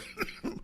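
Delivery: choking cough; a short choked cough; coughing up water. Real, not acted. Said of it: "coffee went down the wrong pipe".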